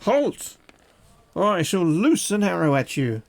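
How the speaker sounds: high, strident sentry calling out halt; guard's call. A narrator's reading. Hidden archer halts the rider, loud guard call. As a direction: bark the challenge at a stranger in the dark. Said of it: "you hear a man's voice say".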